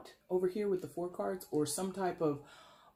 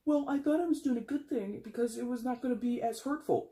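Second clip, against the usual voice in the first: higher, mock self-justifying plea; a hint of a defensive wrongdoer; voicing the rationalizer; 6 semitones higher than usual. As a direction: play the one excusing themselves, lightly. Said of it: "that rationale that I just explained".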